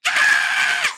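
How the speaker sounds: very high retold scream; imitated fan scream. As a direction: let out a high mock fangirl scream. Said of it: "the whole time she's screaming".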